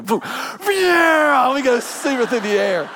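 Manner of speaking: high, slowed-down swooping exclaim; thrilled whoosh. Retold or imitated. Pastor retelling banking the jet, thrilled swoop. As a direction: ride the turn with the words. Plane banks through the clouds.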